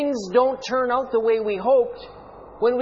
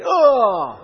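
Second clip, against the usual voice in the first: loud groaning "Ugh" of pain; 5 semitones higher, 8 dB louder than usual. Shown as a demonstration. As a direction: groan it hard, like real hurt. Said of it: "Some will say".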